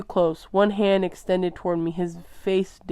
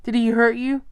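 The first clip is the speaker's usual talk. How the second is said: louder, higher, nearing anger; worried father turning angry; edging toward anger; 5 semitones higher, 5 dB louder than usual. A narrator's reading. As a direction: press him, getting angry. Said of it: "His voice edged toward anger".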